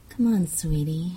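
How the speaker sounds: ordinary delivery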